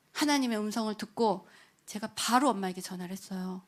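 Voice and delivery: usual speaking voice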